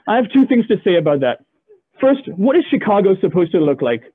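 usual speaking voice

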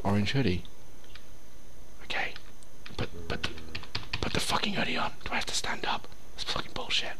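very soft, hushed voice; whispering. Real, not acted. Player telling his character to dress, whispering. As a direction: keep it down to a whisper. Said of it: "Why the fuck am I whispering".

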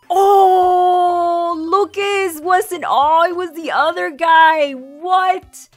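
loud startled outburst; shocked shout. Real, not acted. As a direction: cry out in shock. Shocked by sudden death.

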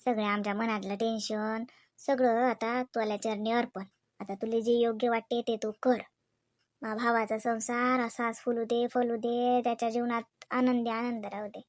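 whispered-down temple prayer; hushed prayer. Acted. Prays aloud while filling oti.

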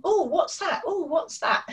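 lightly voiced curious child, high; a hint of a toddler pointing and asking. Shown as a demonstration. Toddler pointing at objects asking parent, lightly high curious. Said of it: "Sometimes they're saying".

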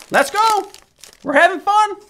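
excited. Real, not acted. Hyped pack opening.

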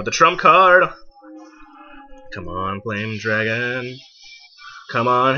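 normal talking voice

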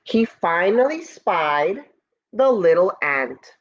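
ordinary delivery

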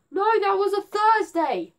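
high, loud sudden exclamation; loud excited outburst; excited realisation. Real, not acted. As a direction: blurt out the correction, thrilled.

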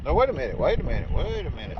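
usual speaking voice

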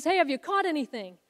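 hailing fishermen from the beach; calling out. Retold or imitated. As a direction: shout it out to the boat from shore. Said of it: "he calls out to them".

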